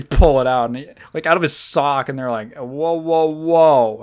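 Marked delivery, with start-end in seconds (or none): 2.6-4.0 getting louder, startled whoa; mock surprised whoa. Guards pull it out of his sock, startled and rising. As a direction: react like you just found contraband.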